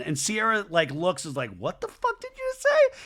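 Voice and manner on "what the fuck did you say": mock outraged high-pitched squeal. Sierra confronts Jesse over his remark, outraged squeal. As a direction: voice shoots way up in disbelief.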